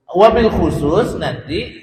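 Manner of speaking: ordinary delivery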